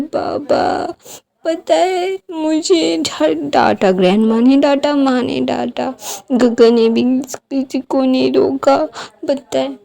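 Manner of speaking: put-on high, slow, breathy sobbing child; tearful child. Wing tells the old alpha everyone scolded him, crying. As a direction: let it come out between sobs.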